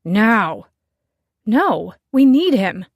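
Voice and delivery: loud, clipped shouted retort; shouting match. Acted. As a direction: shout each other down. Shouted quarrel between Eric, Nell.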